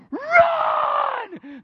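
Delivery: high, loud yell to the fireman; crowd shout. Retold or imitated. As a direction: scream it like the whole stadium. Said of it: "the whole stadium goes".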